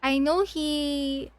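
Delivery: ordinary talk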